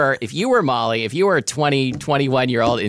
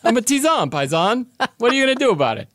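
much higher, strained wise-guy voice; tough-guy chamomile; 3 dB louder than usual. Acted. Herbal infusion daring the listener, tough-guy voice. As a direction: challenge them like a cartoon street tough. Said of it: "I sound really tough".

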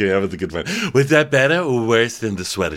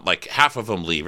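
ordinary talk

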